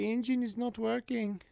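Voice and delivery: light high mock Indian accent, softly. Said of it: "she sounded kinda like Indian".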